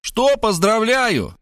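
high, drawn-out retort; indignant high mother voice; angry. A narrator's reading. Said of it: "рассердилась мать".

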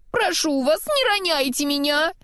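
high, pleading snowman. A narrator's reading. Snowman's head begging not to be dropped, pleading. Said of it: "взмолилась голова".

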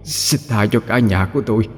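weak, hushed begging; trembling plea. A narrator's reading. Beaten ghost begs the demon to spare her family, trembling plea. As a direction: plead softly, afraid for the family. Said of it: "cất giọng run rẩy van xin".

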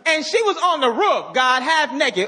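high, laughing excuses; mock excuse-making David. Preacher mocking David blaming the woman, high and laughing. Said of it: "Why didn't he say".

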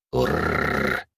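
low, rolling cat growl; soft, deep purring growl; puma growl. A narrator's reading. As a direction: trill a low "urrr" like a puma answering. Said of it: "ответила пума".

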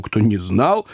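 ordinary delivery, nothing marked

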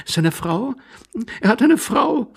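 high, incredulous feminine exclamation; lady overcome with amusement. Acted. Lady hearing the stranger is married, high and amused. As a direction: rise high in astonished amusement. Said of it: "die ihr Lachen nicht mehr meistern konnte".

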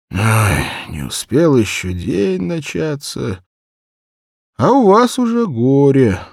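high, put-on old shoemaker voice; grumbling old man. Drunk shoemaker grumbles at the girl, put-on old man voice. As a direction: sigh over the trouble, head shaking.

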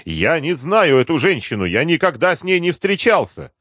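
high-pitched frantic denial; протестует. A narrator's reading. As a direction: protest high, climbing as you go. Deputy frantically denies knowing her. (высокий возмущённый протест)

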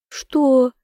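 high-pitched mock indignation; feigned snort. A narrator's reading. Heroine answers her teasing lover, snorting in mock indignation. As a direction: shoot up high in pretend disbelief. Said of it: "фыркнула в ответ наигранно".

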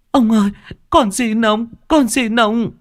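put-on very high, pained child's plea; desperate begging. A narrator's reading. The tortured boy begging Tôn Thái, very high and desperate. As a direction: plead through the pain.

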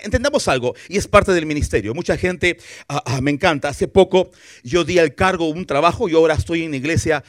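ordinary talk

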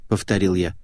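ordinary delivery

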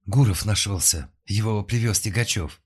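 breathless, loud hurried news; out of breath from running; panting shaky eyewitness. A narrator's reading. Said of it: "запыхавшись".

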